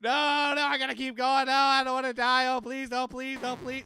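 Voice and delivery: high loud frantic begging; screaming to survive. Pretend. Player pleading to keep going, screamed. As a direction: yell your pleas in mock panic. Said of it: "screaming to survive".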